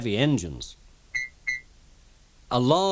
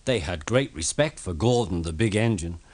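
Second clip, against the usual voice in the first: ordinary delivery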